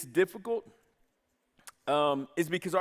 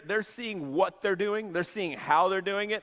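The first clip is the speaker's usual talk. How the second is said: ordinary delivery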